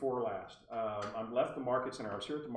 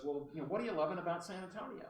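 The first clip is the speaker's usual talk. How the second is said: quick, higher imitation of her accent; Barbara Delano; 6 semitones higher, faster than usual. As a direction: ask briskly in the caller's accent. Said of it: "I have to use her accent".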